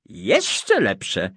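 ordinary delivery

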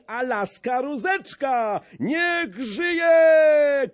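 high and loud, ending in a shout; high excited cheering. A narrator's reading. Brzytwa proclaims Maciej marshal, building to a shout. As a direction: whip up the crowd to a cheer. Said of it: "pisnął Brzytwa".

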